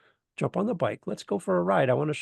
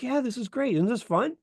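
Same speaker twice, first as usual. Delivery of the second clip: higher, bouncy mentor voice; a mentor to a scared rider, high and bubbly; chirpy enthusiasm; 10 semitones higher than usual. Retold or imitated. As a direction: sell the fun like an eager guide. Mentor cheerfully enjoying the ride.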